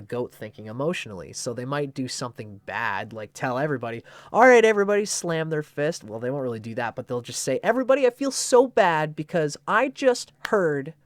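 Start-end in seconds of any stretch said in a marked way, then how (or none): 4.3-6.0 much higher, much louder call to the group; mock rallying shout. Goat rallies the whole house. 7.6-10.9 much higher, louder fake-sorry gossip. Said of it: "they can be bad gossips".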